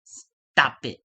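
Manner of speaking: loud, sharp command in a put-on voice; mock stern stop. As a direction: bark it out to shut them up. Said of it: "He says".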